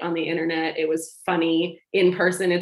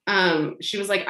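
ordinary talk

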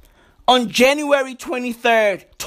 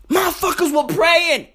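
ordinary delivery

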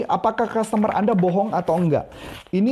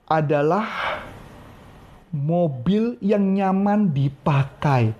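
slow, lower, drawn-out voice; kinesthetic talker; 3 semitones lower, much slower than usual. Shown as a demonstration. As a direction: savour each word slowly. Nah, itu kinestetik. (suara lambat orang kinestetik)

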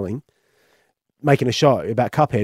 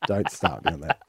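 laughing it off; chuckling through the words; 5 dB quieter, slower than usual. Real, not acted. Laughing at Game of Thrones.

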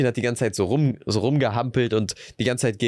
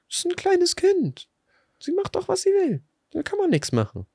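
very high, squeaky mother imitation; indignant mother. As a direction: put on a falsetto mum brushing off complaints. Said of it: "Und dann so".

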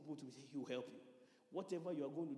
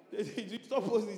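laughing mock panic; 6 semitones higher, 11 dB louder, slower than usual.

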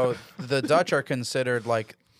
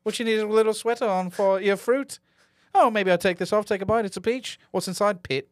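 mock British comedian; 8 semitones higher than usual. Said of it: "This guy's like".

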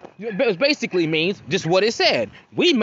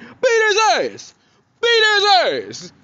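cheering shout; 13 semitones higher, 4 dB louder than usual. Retold or imitated. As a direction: holler like a bystander hyping a whooping. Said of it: "I'm the homie in the background, like".

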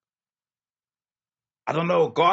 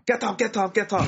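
imitating an old Ghanaian lady ordering; mock elder shooing someone off; old lady on the trotro telling you to get up; bossy old lady; 9 semitones higher than usual. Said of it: "the old lady will come".

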